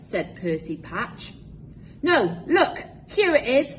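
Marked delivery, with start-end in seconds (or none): 2.0-3.7 higher, louder eager cry; a discovery called out in delight; excited cry. A narrator's reading. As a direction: call out the find with glee. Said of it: "cried Peg Polka Dot".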